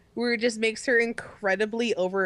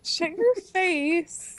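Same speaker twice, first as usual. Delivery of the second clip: high, very drawn-out comeback; slow, high mock outrage; mock-angry retort; 8 semitones higher, much slower than usual. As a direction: playfully stretch out each word, pitched up. Teased speaker snaps back playfully.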